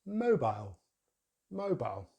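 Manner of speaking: mobile as MOH-bile; British pronunciation. Shown as a demonstration. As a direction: rhyme the end of mobile with mile. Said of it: "So in British English".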